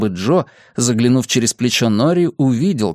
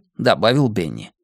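usual speaking voice

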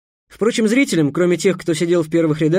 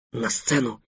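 whisper; 4 semitones lower, 7 dB quieter than usual. Acted. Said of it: "прошептал Бамбура".

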